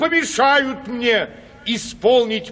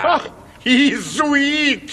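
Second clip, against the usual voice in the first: mocking scorn; 6 semitones higher, slower than usual. Mocking exclamation at sugar.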